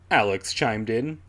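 usual speaking voice

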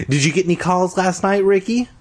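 high, fast teasing taunt. A narrator's reading. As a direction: sneer it sweetly. Tasha taunts Ricky.

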